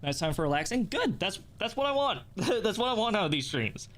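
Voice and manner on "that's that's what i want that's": stuffy nosebleed voice. Real, not acted. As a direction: talk through a nosebleed. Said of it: "the reason that I sound like this, I got a nosebleed".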